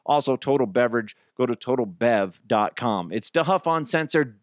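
ordinary delivery

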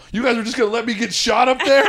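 very high, indignant retold yelp; mock outrage. Friend offstage to comics who didn't help, hammed-up outrage. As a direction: accuse your friends, half laughing.

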